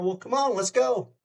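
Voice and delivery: high, easy come-along; breezy confidence. Retold or imitated. Narrator leading the star backstage, high breezy invitation. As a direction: cheerfully wave him along.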